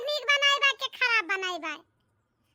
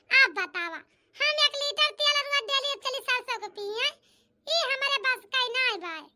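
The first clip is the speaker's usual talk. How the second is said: usual speaking voice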